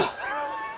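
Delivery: very high, breathless laughing shriek; ecstatic laughing scream. Real, not acted. Winning shootout moment.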